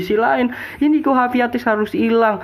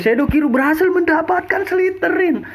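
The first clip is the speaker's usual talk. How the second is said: excited shout; 10 semitones higher than usual. Real, not acted. Shoutcaster peak on kill.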